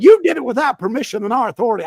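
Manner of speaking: fast, loud shout of a scolding superior; retold shout. Mission assistant scolding missionary over permission, fast loud shout. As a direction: bark the accusation fast and loud, like a scolding boss.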